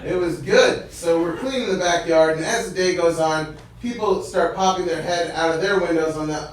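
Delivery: ordinary delivery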